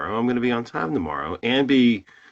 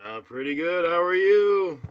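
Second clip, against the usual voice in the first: put-on voice, swooping higher; high, louder fake-voice greeting; 7 semitones higher, 4 dB louder than usual. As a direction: slide up into a fake host voice. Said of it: "doing the fake voice".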